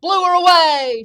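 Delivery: high, very loud trumpeting boast; slow booming elephant brag; shouting elephant. A narrator's reading. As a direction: shout it, stretching out the baroo. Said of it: "shouted the elephant".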